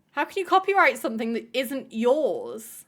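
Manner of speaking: normal talking voice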